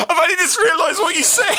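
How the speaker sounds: high, laughing shout; laughing realisation. Real, not acted. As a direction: burst out laughing as it dawns.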